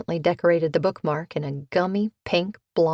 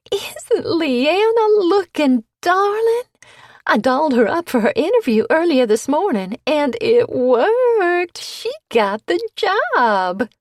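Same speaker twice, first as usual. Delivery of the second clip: much higher, louder gushing voice; gushing southern belle; 10 semitones higher, 7 dB louder than usual. A narrator's reading. Bitsy to Cleo, gushing about Leanna with much higher pitch. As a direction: coo it like a proud hostess.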